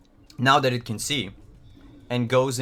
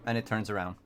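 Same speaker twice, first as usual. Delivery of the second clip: ordinary talk, nothing marked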